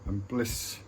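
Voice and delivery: slow, measured poem reading with long pauses; poem recitation. Acted. Speaker sharing a poem with viewers, slow solemn recitation. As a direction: declaim each short line, then let it hang.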